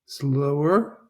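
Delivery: ordinary talk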